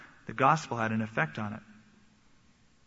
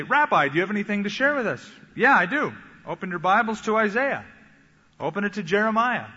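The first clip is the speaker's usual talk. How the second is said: lively dialogue; 6 semitones higher, 5 dB louder than usual. Retold or imitated. Synagogue dialogue acted out.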